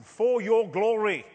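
loud, high, drawn-out proclamation; loud declaration. Retold or imitated. Preacher answering the voice of the Lord, out loudly. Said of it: "I said out loudly".

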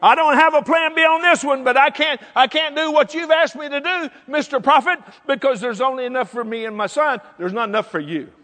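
hammed-up widow's objection; acted refusal. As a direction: push back at the prophet. Acts out widow refusing the prophet.

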